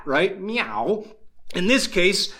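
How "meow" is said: softer meow, rising at the end; cat sound. Shown as a demonstration. Preacher shows how a cat sounds, cat sound. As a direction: imitate a cat's questioning meow. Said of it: "comes from the mouth of the cat".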